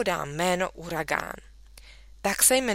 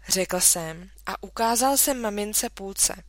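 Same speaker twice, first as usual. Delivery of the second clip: plain reading voice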